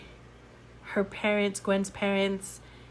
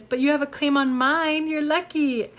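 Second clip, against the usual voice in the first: high, louder playful comeback; cheeky gargoyle; 5 semitones higher, 5 dB louder than usual. Retold or imitated. Little gargoyle answering the girl back, high cheeky voice. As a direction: pitch it up like a cute little creature.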